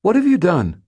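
very high, loud frightened cry; terrified, high-pitched outburst; high, squeaky fear. A narrator's reading. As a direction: demand it in a squeaky panic. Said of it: "my voice high and squeaky with fear".

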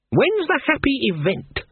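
high, icy and cutting. A narrator's reading. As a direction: ask it coldly, full of disdain.

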